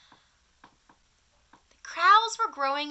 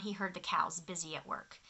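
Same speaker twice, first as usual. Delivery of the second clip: usual speaking voice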